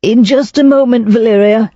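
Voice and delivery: calling after. Acted. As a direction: raise the voice to reach her across the hall. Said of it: "he called after his wife".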